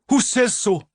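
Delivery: high loud retort; barking back. Acted. Milly barking back at Missus Coleman, loud and defiant. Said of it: "she barked back".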